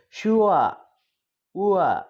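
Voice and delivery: "sure" as shu-a, r left silent; model pronunciation. Shown as a demonstration. Teacher showing a diphthong, "sure" as shu-a. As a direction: glide from oo down to a in "sure". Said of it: "like that".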